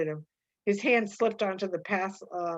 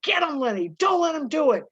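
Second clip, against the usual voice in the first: louder, higher urging shout; yelling; 7 semitones higher, 5 dB louder than usual. Acted. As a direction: yell encouragement across the room. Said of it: "George was on his feet yelling".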